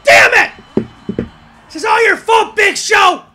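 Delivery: loud, high accusing yell; real anger; angry blame. Real, not acted. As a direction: hurl the blame at Big Show. Blaming Big Show in anger.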